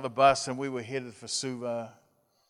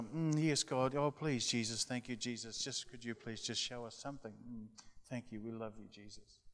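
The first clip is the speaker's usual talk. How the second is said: much softer, hushed imitation of girls praying; girls' tender prayer; 9 dB quieter than usual. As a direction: fade into a hushed, pleading murmur. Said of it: "the girls are".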